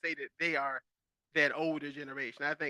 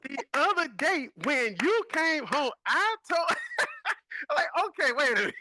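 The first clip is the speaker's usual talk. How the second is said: high, laughing mock scold; hammed-up mama mid-whipping, laughing through it; 9 semitones higher, 3 dB louder than usual. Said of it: "my mama had paragraphs".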